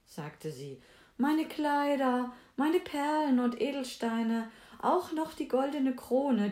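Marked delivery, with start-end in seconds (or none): 1.2-6.5 higher, pleading princess voice; lilting high princess listing her riches; eager princess offering treasures. A narrator's reading. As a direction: offer it all eagerly, pitched up.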